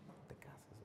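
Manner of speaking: unvoiced secret whisper; whispered warning. The complaining husband whispering his warning to a friend. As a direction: hush it like a secret aside.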